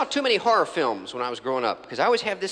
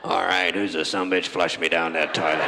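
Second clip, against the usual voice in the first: menacing giant spider; 10 semitones lower than usual. Acted. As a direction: lower menacing monster growl, slow at the end. Giant spider comes back for revenge.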